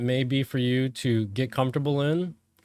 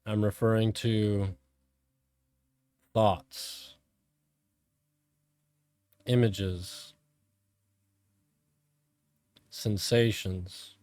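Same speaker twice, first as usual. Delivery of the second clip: calm, measured meditation voice with long pauses; guided meditation; 3 semitones lower, 3 dB quieter than usual. Real, not acted. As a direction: guide them inward, phrase by phrase, letting each one settle.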